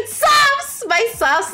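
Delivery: high, bright call of a nickname; excited greeting. Real, not acted. Streamer calls out to a chatter, excited and high. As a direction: greet a regular with a big high shout.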